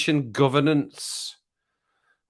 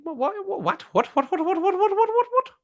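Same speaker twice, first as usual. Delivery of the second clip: squeaky repeated what. Acted. Baffled by viewer comment.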